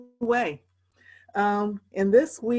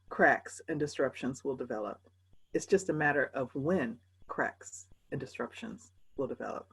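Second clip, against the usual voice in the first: dropping lower and softer to a near hush; low and fading; 7 semitones lower, 7 dB quieter than usual. Real, not acted. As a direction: let the point sink down low. Sermon point fading low.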